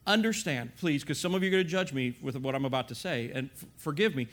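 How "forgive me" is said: ordinary delivery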